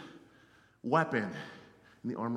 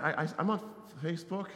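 ordinary delivery

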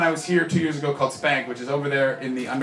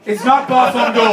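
loud, higher mock indignation; Ross; 8 semitones higher, 9 dB louder than usual. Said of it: "I just did Ross's version of the finger".

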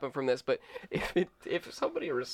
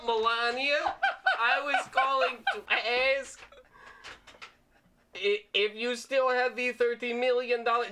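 higher-pitched, halting prank-call voice; posing as Melania; 5 semitones higher than usual. Acted. Prank caller leaving a voicemail as Mrs Trump, put-on higher voice. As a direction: put on a First Lady voice, half cracking up.

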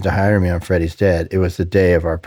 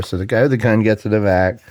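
ordinary talk

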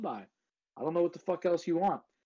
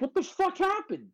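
mock-shocked high cry; retold disbelief. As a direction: pitch jumps way up in shocked disbelief. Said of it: "And I was like".

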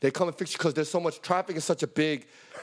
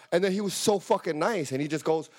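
ordinary talk

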